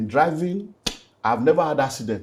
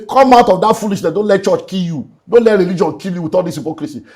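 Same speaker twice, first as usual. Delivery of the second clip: forceful call-out; 5 semitones higher, 6 dB louder than usual. Real, not acted. Pastor calling out church hypocrisy.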